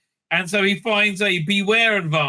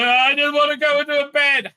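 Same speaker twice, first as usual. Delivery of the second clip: mock whiny Hannah; 10 semitones higher, 5 dB louder than usual. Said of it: "she's like".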